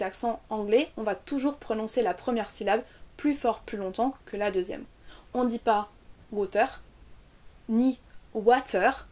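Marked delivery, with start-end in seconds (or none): 6.3-9.1 WATER French-style, even syllables, full "-teur" ending; French accent. Shown as a demonstration. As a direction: say WATER as French learners do, hard t. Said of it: "comme on le dit souvent en français".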